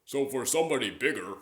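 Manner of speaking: lightly voiced big person. Shown as a demonstration. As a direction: open the throat like a large man. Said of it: "it feels like you widen your throat".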